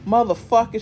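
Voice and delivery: loud, high furious screech. Acted. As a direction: shriek it in a rage. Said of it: "she screeched in a fury".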